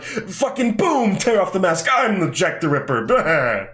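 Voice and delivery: high, hammed-up villain reveal; mock unmasking, big and cartoonish; Jack the Ripper. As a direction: burst out theatrically, stretching the words. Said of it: "and he's like".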